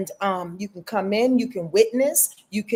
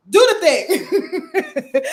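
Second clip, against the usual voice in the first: mock command; 8 semitones higher, 6 dB louder, much slower than usual. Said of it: "you'll light the candle and be like".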